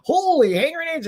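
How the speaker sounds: gasp, then high-pitched surprise; startled exclamation. Real, not acted. Streamer glimpses something mid-chat, startled exclamation. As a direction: exclaim it on a sharp intake of breath.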